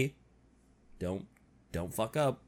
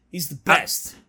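ordinary talk

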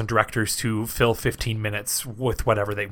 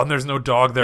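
ordinary talk, nothing marked

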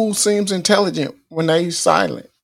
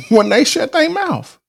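laughing; 10 semitones higher than usual. Real, not acted. Laughing at the fool idea.

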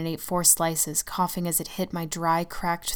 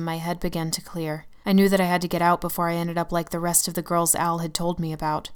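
ordinary delivery, nothing marked

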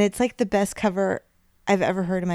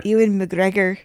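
louder, hammed-up Scottish name; attempted Scottish accent; 5 dB louder than usual. Shown as a demonstration. Co-host tries the actor's name, attempted Scottish accent. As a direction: have a loud go at Scottish. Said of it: "words that sound really Scottish".

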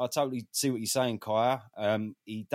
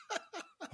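short laugh; 11 dB quieter than usual. Real, not acted. Laugh mid-story.

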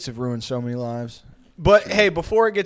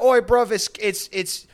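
loud, higher mock British chav rant; mock chav accent; 7 semitones higher, 4 dB louder, slower than usual. Mocking an English bloke on camera, chav accent. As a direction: shout it like a street-interview chav. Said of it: "It's like".

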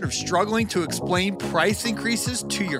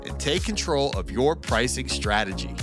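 infomercial; 5 semitones higher, 6 dB quieter than usual. Acted. Recorded promo read over music.

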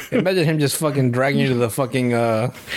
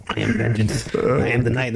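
Batman; 4 semitones lower than usual. Acted. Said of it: "I'm Batman".

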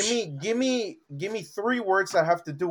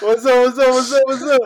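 louder shouted streamer hello; loud hype greeting; 7 semitones higher, 11 dB louder than usual. Acted. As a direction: yell it to the crowd.

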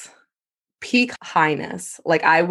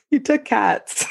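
laughing through the words, higher; can't keep a straight face saying it; words bubbling with laughter; 10 semitones higher, slower than usual. Real, not acted. Laughing at roommate's nerve.

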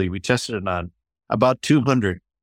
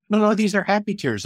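put-on young woman's voice; 12 semitones higher than usual. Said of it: "She goes".

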